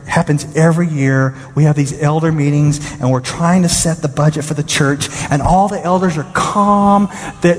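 ordinary delivery

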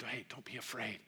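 soft, quiet comforting hush; hushed reassurance. Retold or imitated. The angel soothes the trembling women, quiet and gentle. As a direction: soothe them gently, almost under the breath. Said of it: "they're saying".

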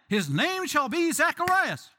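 high, loud, slow proclamation; bossy relatives' decree. Acted.

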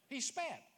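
"spat" with a wet, spitting burst on the p and t; spitting pronunciation. Shown as a demonstration. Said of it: "I'm just pronouncing it phonetically".

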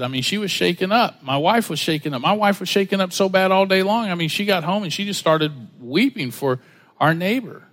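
ordinary talk

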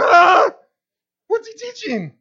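loud, high, baffled cry of confusion; mock puzzled outcry; puzzled shout. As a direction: shout it like a lost, head-scratching listener. Listeners voiced as puzzled, calling out.

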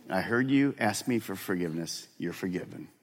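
lightly voiced God, tender; God forgiving. God gently forgiving the guilty listener, soft and tender. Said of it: "you hear God say".

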